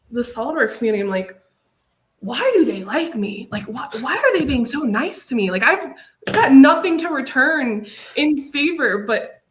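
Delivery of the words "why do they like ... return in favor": retold bewildered self-doubt, swooping higher; self-deprecating disbelief. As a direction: imitate your own incredulous inner voice, pitch swooping up. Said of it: "some self-detriment talk".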